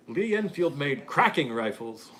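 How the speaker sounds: slow, lightly put-on British accent; a hint of a British gent, slow. Reading a UK viewer's comment, light British accent. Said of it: "I won't try to do the accent".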